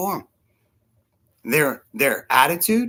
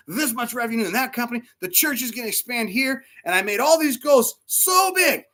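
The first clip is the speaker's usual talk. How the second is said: big goals piled up, climbing ever higher; building excitement; 5 semitones higher than usual. Retold or imitated. Preacher recalling January's huge goals, mounting excitement. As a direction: build from firm to high-pitched hype.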